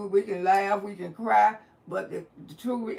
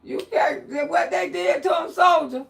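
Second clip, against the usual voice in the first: raised voice, higher and excited; amused hype-up; 5 semitones higher, 4 dB louder than usual. Real, not acted. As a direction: egg the listeners on, loudly. Host hyping up the caller's story.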